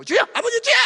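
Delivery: very high, loud fervent cry; crying out to God. Retold or imitated. Deacons praying aloud together, fervent cry. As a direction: call out to the Lord.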